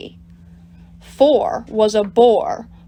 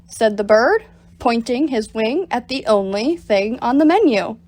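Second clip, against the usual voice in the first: usual speaking voice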